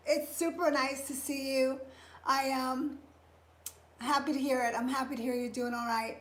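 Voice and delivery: ordinary delivery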